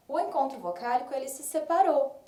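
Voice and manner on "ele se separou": ordinary delivery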